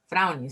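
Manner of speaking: English "frowning" dropped into Spanish speech; English word. Shown as a demonstration. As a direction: give "frowning" its English sounds, not Spanish. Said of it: "se dice en inglés".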